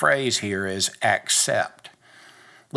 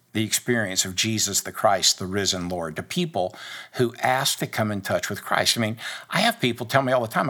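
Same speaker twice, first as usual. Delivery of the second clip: ordinary talk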